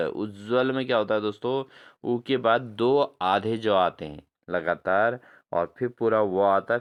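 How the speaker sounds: normal talking voice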